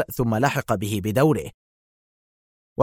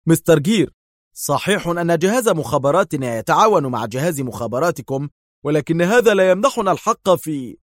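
sharply; 6 semitones higher than usual. A narrator's reading. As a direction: cut in hard. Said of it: "قائلا في حدة".

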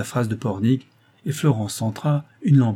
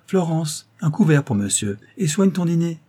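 higher, brisk summons to a servant; calling an order; 7 semitones higher, 3 dB louder than usual. A narrator's reading. Mistress orders dinner from her servant, calling out. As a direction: call out instructions, pitch raised.

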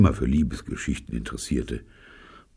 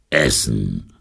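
low growled demand; 5 semitones lower than usual. A narrator's reading. Hungry intruder demanding food at the door, growling. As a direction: growl the word deep in the throat. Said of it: "knurrte der Kerl".

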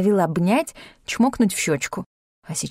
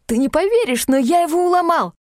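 hurried excitement; 8 semitones higher than usual. A narrator's reading. Climber rushes to Tanya's table with news, hurried and thrilled. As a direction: blurt it out in triumph. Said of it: "торопливо произнёс".

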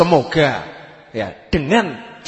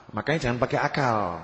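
ordinary talk

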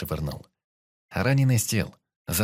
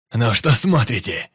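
loud, slow enraged yell; furious shout; 3 semitones higher, 8 dB louder than usual. A narrator's reading. Wild-eyed man yelling at his frozen squad, furious. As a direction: bark it at the crowd in a rage. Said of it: "кричал он с бешеным выражением лица".

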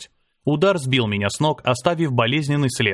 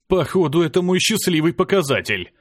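mild groan, higher in pitch; understated higher-pitched groan of complaint; 6 semitones higher than usual. A narrator's reading. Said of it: "простонал я".

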